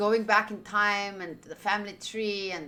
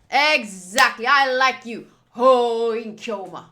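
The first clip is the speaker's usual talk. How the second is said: loud, theatrical self-proclaiming; a loud, hammed-up villain boast; grandiose declaration; 9 dB louder, slower than usual. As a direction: announce yourself like a mad scientist. Mad scientist persona declaration.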